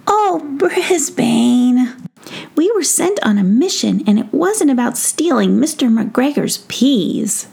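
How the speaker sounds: high-pitched gnome gently scolding; exasperated gnome. Acted. Tom Gnome scolds his mouse friend about stolen peas, exasperated.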